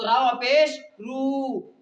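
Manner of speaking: रावा पेश रू read slowly, the रू held long; stretched reading. Shown as a demonstration. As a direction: draw out रू as the teacher shows it. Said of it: "उसको खींच करके पढ़ते हैं".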